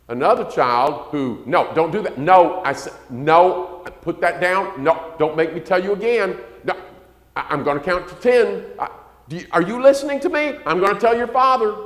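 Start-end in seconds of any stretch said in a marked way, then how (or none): 1.5-11.8 high, loud exasperated nagging; scolding mother. Retold or imitated. Mother scolding a child who won't put things down, loud nagging. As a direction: nag and threaten like a frazzled mom.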